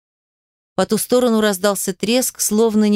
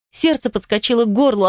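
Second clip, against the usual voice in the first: ordinary delivery, nothing marked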